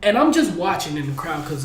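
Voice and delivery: usual speaking voice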